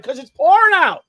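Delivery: high, loud, emphatic reply; exasperated answer. Retold or imitated. Owner telling the dog why she stays inside, exasperated. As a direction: raise the voice on an obvious reason.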